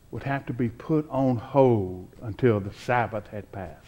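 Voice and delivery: normal talking voice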